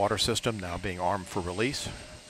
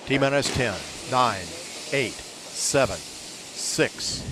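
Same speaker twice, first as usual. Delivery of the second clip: loud countdown; 5 dB louder, much slower than usual. Real, not acted. Launch countdown building to liftoff.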